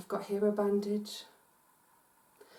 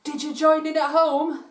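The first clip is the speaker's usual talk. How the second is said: loud, high, excited question; big lively presenter's shout; rousing call-out; 7 semitones higher, 11 dB louder than usual. Real, not acted. Calling out to children after yell.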